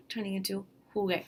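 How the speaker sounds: jugué, hard G before the E. Shown as a demonstration. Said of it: "we add a U after the G".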